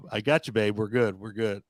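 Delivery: low, soft, easy reassurance; mock-soothe her, low and quiet; reassuring. Retelling how he told a friend he understood, reassuring. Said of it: "Well, I'm like".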